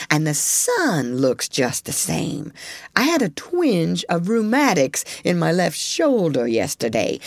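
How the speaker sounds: put-on slow, grumbly old granddaddy voice; old beaver spinning a warning.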